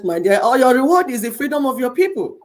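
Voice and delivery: normal talking voice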